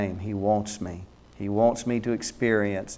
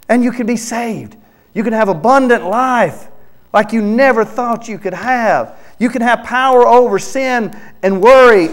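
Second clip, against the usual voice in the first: much higher, louder pleading cry; Jesus crying out; 12 semitones higher, 5 dB louder than usual. Acted. Jesus calling to sinners from the cross, high pleading cry. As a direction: plead with the sinner as if with a last breath. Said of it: "that's what Jesus was saying as his last cries".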